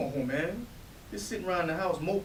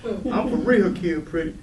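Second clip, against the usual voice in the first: excited; 4 semitones higher, 7 dB louder than usual. Acted. Announcing he'll be a father.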